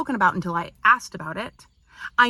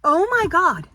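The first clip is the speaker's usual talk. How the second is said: exasperated oh my God; 8 semitones higher, 4 dB louder than usual. Real, not acted. Exasperated outburst.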